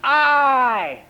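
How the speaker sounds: put-on toddler's loud, high, drawn-out announcement; little toddler. Toddler showing mother his eye, high and loud. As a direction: proudly call out one word like a toddler. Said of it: "and he goes".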